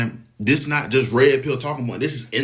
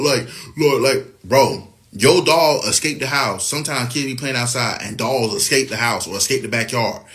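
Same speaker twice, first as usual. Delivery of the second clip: normal talking voice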